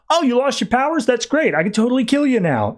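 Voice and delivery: high, gleeful mock villain. Uker gloating at Superman, high mocking glee. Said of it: "hears that and is like".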